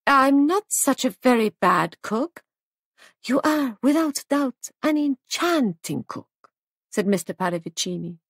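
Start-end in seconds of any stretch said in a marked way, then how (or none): 0.1-2.4 higher, slow, a touch anxious; slight anxiety. A narrator's reading. Hostess defending her cooking to a guest, slightly anxious. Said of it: "Molly's voice betrayed slight anxiety". 3.2-6.5 high, slow foreign charmer; lavish the compliment on her, drawn out; gallant flattery. A narrator's reading. Foreign guest flattering the hostess on her cooking, high and gallant.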